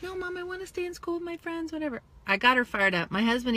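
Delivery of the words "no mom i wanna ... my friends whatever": put-on higher, quick whiny kid voice; whiny daughter. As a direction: mimic a reluctant child. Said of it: "At first, she's like".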